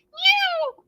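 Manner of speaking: high, sharp mock meow; cat meow. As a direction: yowl like an angry cat. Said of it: "she arched her royal fur".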